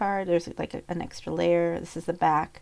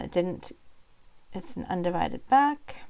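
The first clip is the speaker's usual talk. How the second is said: normal talking voice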